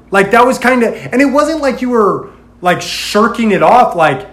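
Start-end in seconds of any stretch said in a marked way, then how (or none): none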